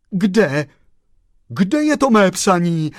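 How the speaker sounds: high, loud urgent cry; eager cry. Acted. Chauffeur cries out for his lost letter, high and loud. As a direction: call out desperate for the letter. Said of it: "zvolal pan šofér Frantík".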